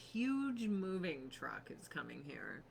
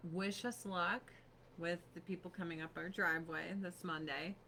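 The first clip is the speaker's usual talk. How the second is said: ordinary talk, nothing marked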